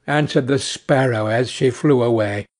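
plain reading voice

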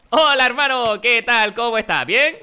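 high, loud, cheery put-on hello; KS Style greeting. Gamer imitating another youtuber's greeting, exaggerated cheer. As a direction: ham up another youtuber's intro. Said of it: "voy a hacer la del K S Style".

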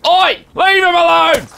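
high-pitched challenge; indignant shout. Real, not acted. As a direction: call out to scare off an attacker. Defending teammate in game.

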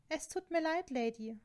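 usual speaking voice

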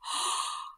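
quick unvoiced gasp; sharp gasp of realization. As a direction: suck in air as it dawns on you. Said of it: "And I was like".

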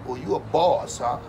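normal talking voice

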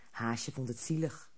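plain reading voice

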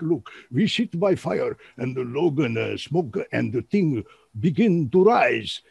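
broken-English storyteller with a strange Sumerian accent; crazy Sumerian accent. Shown as a demonstration. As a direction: sell it in a crazy Sumerian accent, slowing to the end. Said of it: "I'm selling it in this crazy accent".

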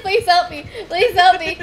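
laughing through a whiny plea; laughing mock plea. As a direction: laugh while begging for help. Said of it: "He's like".

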